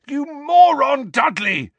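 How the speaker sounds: high, loud angry yell; pained, furious shout. A narrator's reading. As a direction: yell it, leaning hard on the insult. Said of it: "Harry yelled".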